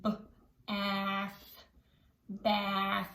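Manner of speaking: B, ath, bath split into parts, then blended; sounded out slowly. Shown as a demonstration. Teacher leads kids sounding out bath. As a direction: break it apart then blend it. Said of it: "say the word together slowly".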